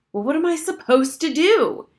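put-on a girl losing patience with the doctor; getting angry. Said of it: "Harriet was getting quite angry".

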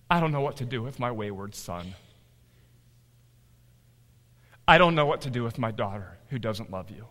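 low, heavy-hearted prayer; subdued confession. Acted. Parent praying about wayward children, low and subdued.

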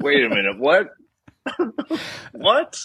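laughing through a startled wait a minute, what; real laughter breaking into the words; laughing disbelief. Real, not acted.

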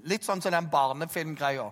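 ordinary delivery

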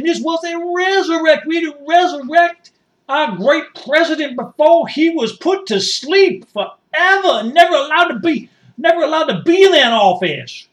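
high, impassioned rally oratory; fervent proclamation. Acted. Supporter defending his great president, fervent proclamation.

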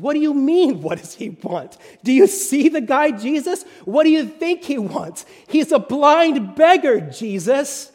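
exasperated, can't believe the question; incredulous. Acted. Crowd scoffing at Jesus's question, loud incredulous.

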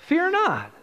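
"Fear not" lifted high with a falling "not"; climactic repeat. Shown as a demonstration.

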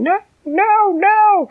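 high, loud tiny ant cry; yelling. A narrator's reading. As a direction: shout no with all your might. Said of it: "Dina was yelling".

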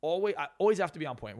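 ordinary talk, nothing marked

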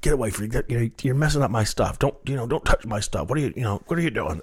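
half-acted scolding of the rookie; mild mock yell. Old photographer yelling at young reporter near podium, mild mock angry yell. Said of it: "this old photographer yells at me".

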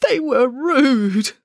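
high, slow, crying bear voice; sobbing. Acted. Bear telling the family about the rude crew, crying. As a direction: let the words break into a sob. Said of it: "Boris sobbed".

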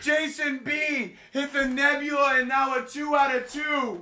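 high, thrilled pull call; hype announcer shout. Real, not acted. Streamer announcing Jason B's second hit, high hyped shout. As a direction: keep the excitement ringing to the end.

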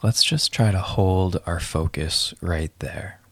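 calm breath-counting guide voice; guided meditation. Acted.

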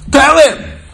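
sudden high, loud order; commanding shout. Retold or imitated. God cutting into the preacher's argument, shouted command. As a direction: bellow it as an exasperated command.

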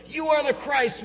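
high, loud declaration; bold proclamation. Retold or imitated. Peter's bold declaration, retold.

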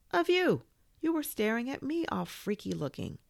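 high-pitched accusation; indignant high protest; accusing. Acted. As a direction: turn the blame back, voice way up. Toy accuses the boy.

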